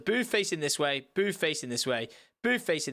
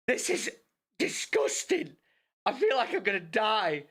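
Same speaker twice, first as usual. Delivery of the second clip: much higher squeaky cringe; mortified; 4 semitones higher than usual. Retold or imitated. Cringing at his own awkwardness, high mortified squeal. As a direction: squeal it like you want to vanish. Mortified inner thought in restaurant.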